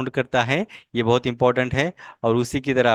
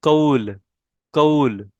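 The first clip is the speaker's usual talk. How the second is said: ordinary talk, nothing marked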